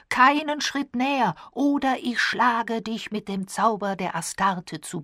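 loud, high-pitched threat; calling out. A narrator's reading. Diana threatens the approaching man with magic, loud cry. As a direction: start high and forceful, easing slightly. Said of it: "rief sie".

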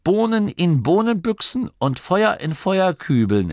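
high-pitched, emphatic reminder; erinnernd. A narrator's reading. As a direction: recite it like an obvious rule. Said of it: "erinnerte Masklin". (nachdrücklich erinnernd, hohe Stimme)

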